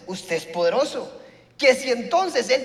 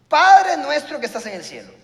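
proclaimed; 3 semitones higher, 7 dB louder than usual. Shown as a demonstration. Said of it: "Oren de esta manera".

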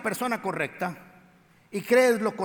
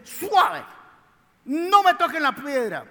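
much higher and louder, a sudden cry of stop; alarmed protest; 7 semitones higher, 6 dB louder, slower than usual. Acted. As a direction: cry out to stop the men touching the stone. Marta protesting, voiced by preacher.

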